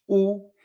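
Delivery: U normal, redonda como en español; U española. Shown as a demonstration. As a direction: pronounce a plain Spanish U. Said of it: "Una U normal, que sería la U española". (U normal, como en español)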